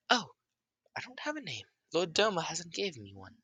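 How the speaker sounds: high-pitched creature voice; newborn creature. Acted. Voices nameless new creature answering.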